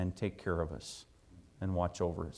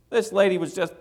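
normal talking voice

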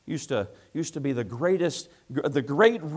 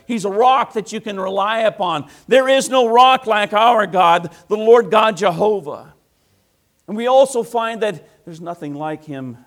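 loud, high preacher's cry; preaching at full force; 10 semitones higher, 14 dB louder than usual. Acted. Preacher proclaiming to the congregation, loud and high. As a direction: lift it to the rafters.

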